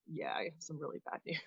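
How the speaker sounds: a hushed, unvoiced whisper; whispered bad news. Recruiter delivers bad news by phone.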